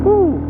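loud excited whoop. Real, not acted. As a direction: let out a wild whoop. Offroad joy shout.

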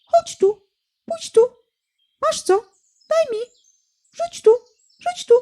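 very high, begging chirp; little quail voice. A narrator's reading. Little quail begging for scraps, very high. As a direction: beg like a tiny quail. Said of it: "Przepióreczka".

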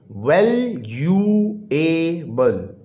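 वैल्यूएबल drawn out slowly, syllable by syllable. Shown as a demonstration. Teacher counts syllables for the class, very slowly. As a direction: break वैल्यूएबल into its four beats. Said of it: "four syllable है".

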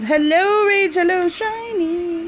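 high, slow sing-song hello; cooing to pets. Real, not acted.

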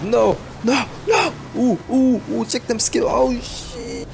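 high-pitched frantic cries; excited panic. Real, not acted. Gamer about to lose the match, high frantic yelps. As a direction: cry it high in short bursts.

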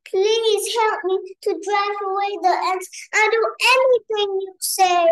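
high-pitched storybook plea; pleading. A narrator's reading. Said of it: "The tiger pleaded with the monkey".

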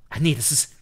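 normal talking voice

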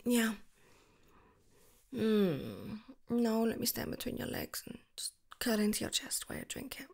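ordinary delivery

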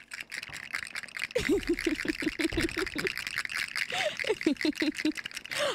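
long high breathy giggles; real giggle fit. Real, not acted. Streamer tickled by the wheel result, giggling fit.

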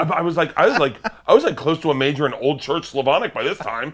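laughing. Real, not acted. Laughing while explaining the Russian major.

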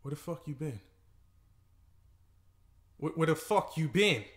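rising furious questioning; angry confrontation. Acted. Man confronting girlfriend who came home at dawn, angry. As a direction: demand answers, building in anger.

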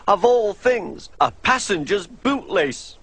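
James the engine. A narrator's reading. James miserable, voiced higher.

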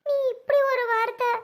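high, crying, wounded voice; tearful reproach. Acted. Sister reproaching her brother for his cruel words, tearful.

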